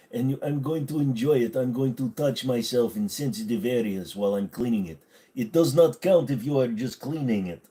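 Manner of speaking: playful put-on Russian voice; Russian accent. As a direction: ham up a Russian accent, sing-song. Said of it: "I read that as Russian".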